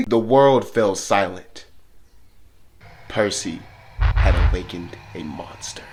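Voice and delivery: dramatic narration. A narrator's reading. Dramatic turn in the story.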